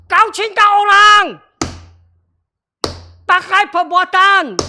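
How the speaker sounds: high, loud preaching command; loud exhortation. Real, not acted. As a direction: call them to act. (seruan khotbah yang lantang)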